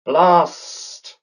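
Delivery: high, exasperated snake cry of Blast!; a frustrated cartoon snake cursing its luck. A narrator's reading. Snake cursing at girls, exasperated high snake voice. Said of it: "it said".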